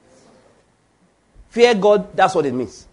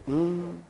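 mock reverent hum; 10 dB quieter than usual. Preacher imitating worshippers standing straight, hushed mock reverence. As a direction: hum it piously under your breath. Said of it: "Everybody just stand straight".

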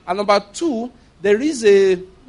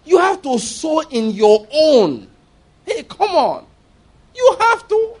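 rising into a high, pleading, slow plea; preacher pleading with his friend to follow his call; impassioned urging; 5 semitones higher, 3 dB louder, slower than usual. Retold or imitated. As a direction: press him harder and harder, almost crying.

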